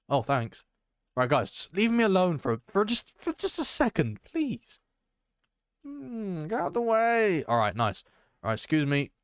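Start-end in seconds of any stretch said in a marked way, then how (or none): 1.5-4.8 plead, rising ever higher to the last word; high, exasperated begging; exasperated plea. Real, not acted. Pleading with players to back off. 5.8-7.4 high, strained "get out of the way"; high-pitched straining grumble; strained high complaint. Real, not acted. Stuck, moving others aside.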